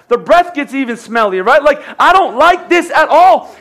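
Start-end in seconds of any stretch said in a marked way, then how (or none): 2.0-3.5 higher and louder, dragged-out pout; mock whine. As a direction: whine it like a spoiled child. Pastor mocks a complainer.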